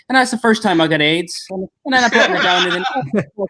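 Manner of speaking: high-pitched mock Irish accent; hammed-up Irish lilt for a punchline. Comedian playing the puzzling cafe stranger, mock Irish accent. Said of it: "there would be one, like, perfect word".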